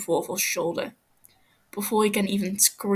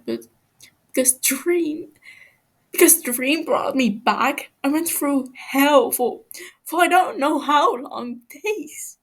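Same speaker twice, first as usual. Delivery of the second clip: higher, stammering and pleading; frightened prisoner begging, voice climbing; scared captive pleading; 5 semitones higher, 5 dB louder than usual. Acted. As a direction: stumble over the words, terrified of him.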